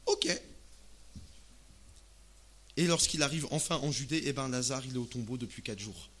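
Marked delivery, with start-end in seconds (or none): none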